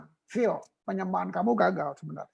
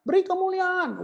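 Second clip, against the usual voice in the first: mock worship leader call; 9 semitones higher than usual. Preacher mimicking the applause cue again, higher and pushier. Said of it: "Kamu maksudnya itu kan?".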